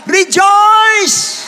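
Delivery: high, loud drawn-out shout; shouted exhortation. Real, not acted. Preacher commands rejoicing in any situation, shouted exhortation. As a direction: belt it out to the whole congregation.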